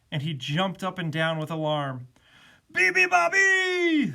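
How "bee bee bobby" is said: slow, high, loud animal call; noisy animal chorus; loud singsong. A narrator's reading. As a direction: sing it out long and blaring. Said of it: "sang the animals".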